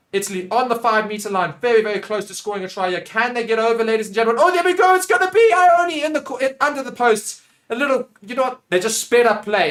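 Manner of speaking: building from keen commentary to a high, loud shout; erupting live commentator; excited try call. Real, not acted. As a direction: let the voice soar as the try is scored. Calls a try as it happens.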